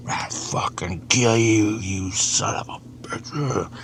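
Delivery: slow, hissing whispered threat; whispered demon threat. Retold or imitated. The biker demon threatening him, slow whispered menace. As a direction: breathe the threat slowly like an evil spirit. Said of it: "he's like".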